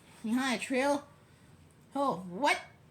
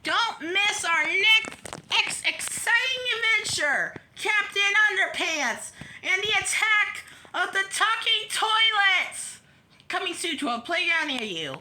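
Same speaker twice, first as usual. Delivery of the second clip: big excited coming-attraction voice; promo announcer; 8 semitones higher, 5 dB louder than usual. Acted. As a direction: hype the next exciting adventure like an announcer. Reads a next-book promo.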